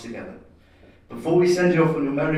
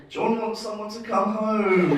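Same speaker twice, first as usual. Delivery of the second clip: mock whiny; 9 semitones higher, slower than usual. Reading a sad Facebook post.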